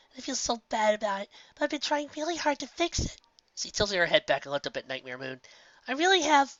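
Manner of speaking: put-on high childlike pleading; a little filly defending herself; young filly voice. A narrator's reading. Filly tells Nightmare Moon she will fix the sun, high childlike voice.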